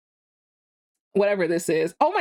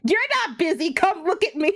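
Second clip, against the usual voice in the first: light, higher mock of a friend's teasing; imitating her friend; 6 semitones higher than usual. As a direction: mimic her, a little singsong. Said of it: "what she's like".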